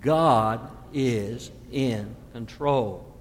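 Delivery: slow, low, spelled-out statement; God is in control said slowly; slow congregation repeat. Shown as a demonstration. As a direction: lead the congregation to say it together. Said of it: "Would you say that with me".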